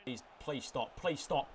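low, very soft plea; quiet pleading. Real, not acted. Player begging the game to stop, quietly pleading. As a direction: plead under your breath.

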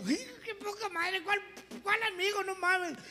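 high, whining disbelief; strained comic exasperation; indignant father. Acted. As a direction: moan it in a squeaky voice. Father laments about the friend.

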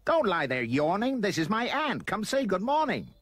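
put-on high, bossy boy's yell. A narrator's reading. Roy orders his pet to greet his aunt, yelling. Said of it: "he yelled".

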